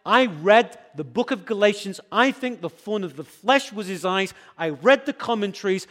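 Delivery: ordinary talk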